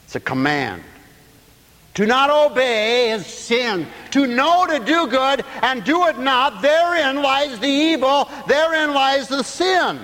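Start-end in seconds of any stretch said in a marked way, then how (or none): 1.9-10.0 high, drawn-out preacher's cadence; full-voiced preaching. Real, not acted. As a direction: preach it out, stretching the phrases high.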